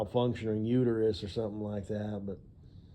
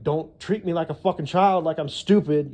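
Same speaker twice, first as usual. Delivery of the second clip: higher and louder, imitating her fury; mock angry outburst; 6 semitones higher, 8 dB louder than usual. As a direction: play her snapping back at being babied.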